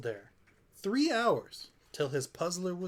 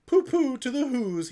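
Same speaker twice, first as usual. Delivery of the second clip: much higher and louder sneering pooh-pooh; gleeful Grinch taunt; 8 semitones higher, 8 dB louder than usual. A narrator's reading. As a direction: crow it out like a gloating villain. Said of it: "he was grinchiously humming".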